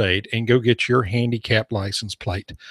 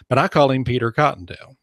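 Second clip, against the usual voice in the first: ordinary talk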